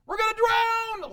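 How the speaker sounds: high, loud panicked shout; terrified disciple screaming in the storm; panicked cry. Acted. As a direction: yell it like you're sinking. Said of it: "disciples' panic voiced".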